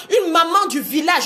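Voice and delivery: normal talking voice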